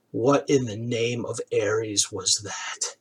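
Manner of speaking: slow, low, hushed disbelief; stunned Norik. Acted. Norik stares up at the two, dazed and hushed. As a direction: let it out slowly and softly, dazed.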